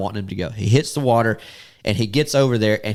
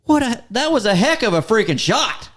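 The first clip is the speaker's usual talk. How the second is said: much higher, excited disbelief; amazed; 11 semitones higher than usual. Retold or imitated. Hunter amazed at his friend's long shot, excited. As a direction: marvel at an impossible shot.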